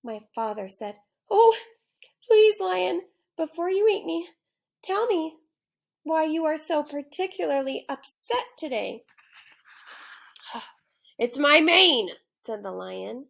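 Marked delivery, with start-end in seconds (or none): 1.3-9.0 high, eager pleading; pleading boy. Acted. Boy begging the lion before being eaten, high pleading. 11.2-12.1 loud, higher lion growl, rushing near the end; a cranky lion who means to eat him; hungry lion voice. Acted. Lion telling the boy he'll eat him now, loud hungry growl.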